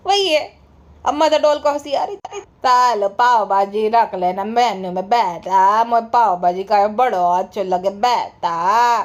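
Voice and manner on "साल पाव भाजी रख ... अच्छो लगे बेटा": low, slow, drawn-out elder's drawl; mocking impression. Imitating someone asking beta for pav bhaji on the menu, mocking impression. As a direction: stretch every word in a lowered, singsong mimicry. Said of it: "ऐसा करके".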